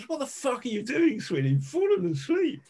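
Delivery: high, incredulous scolding, swooping up in pitch; exasperated observer. Retold or imitated. Observer finds a colleague asleep in pudding, high-pitched mock exasperation. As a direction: imitate an appalled colleague, pitch leaping high. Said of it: "And Roger, the observer, comes over".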